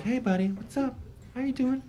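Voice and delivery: put-on high, soft baby talk; sweet talk to cat. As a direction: coo at the cat gently.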